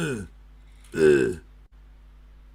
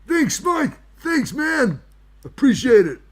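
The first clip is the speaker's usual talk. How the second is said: high grateful wizard; 8 semitones higher, 5 dB louder than usual. Acted. Wizard character thanking Mike.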